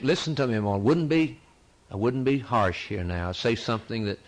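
ordinary talk

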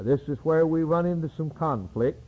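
ordinary talk

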